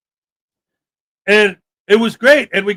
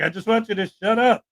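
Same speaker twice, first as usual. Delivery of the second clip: ordinary talk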